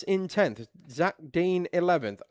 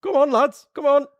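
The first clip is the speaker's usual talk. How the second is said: higher, louder mock rally cry; hammed-up coach egging them on; mock urging Curtis; 10 semitones higher, 9 dB louder than usual. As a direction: hurry the lads along in jest. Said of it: "You're going".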